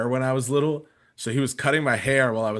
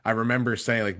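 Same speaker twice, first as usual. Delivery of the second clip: normal talking voice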